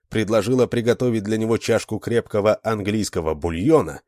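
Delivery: ordinary delivery, nothing marked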